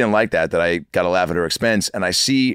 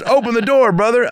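higher, louder Midwest buddy demanding; Midwest accent; 3 dB louder than usual. Retold or imitated. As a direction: insist in a thick Midwest voice. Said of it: "real thick, uh, Midwest accent".

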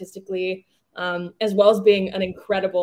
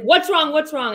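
higher, louder worried call; alarmed parents; 8 semitones higher, 6 dB louder than usual. Retold or imitated. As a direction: imitate the parents' alarmed question. Parents reacting to her scream.

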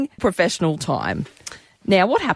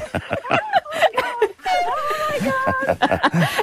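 big laugh. Real, not acted. Host cracking up telling the office story, shrieking laugh. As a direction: burst out laughing at the memory. Laughing after niece imitation.

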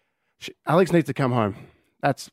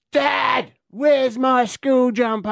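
put-on high, drawn-out teenage holler; mock yell; 11 semitones higher, much slower than usual. Daughter calls Dad about her missing school jumper, imitated yell.

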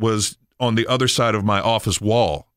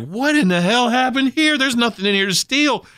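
shocked outburst; 14 semitones higher than usual. Retold or imitated. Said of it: "I'm like".

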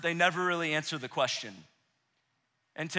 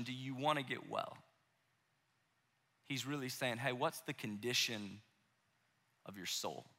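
much softer and lower, almost inward; hushed searching question; 8 semitones lower, 11 dB quieter than usual. Retold or imitated. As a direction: ask gently, reaching for the soul. Jesus probing the soul, hushed.

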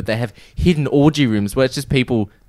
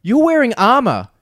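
higher, slow lecturing voice; bossy roleplayer; 8 semitones higher, slower than usual. Retold or imitated. Roleplay gamer scolding a newbie, slow bossy voice. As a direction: lay down the rules slowly. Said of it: "the guy goes".

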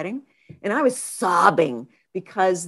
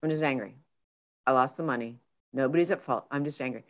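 softer, lower, owning the anger; calm quiet admission; 5 semitones lower, 6 dB quieter, faster than usual. Retold or imitated. Alone on the couch admitting anger, soft and low. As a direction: say it plainly to yourself. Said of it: "I sat down on my couch".

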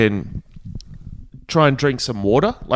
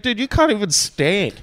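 high-pitched mock incredulity; retold disbelief; 7 semitones higher than usual. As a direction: tell him off in raised-pitch disbelief.

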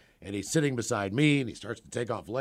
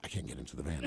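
low, soft, scared refusal; low hushed mock wife; paranoid wife; 11 semitones lower, 12 dB quieter, much faster than usual. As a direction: refuse like it's a death trap.

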